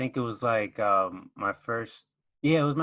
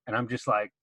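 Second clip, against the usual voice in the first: ordinary talk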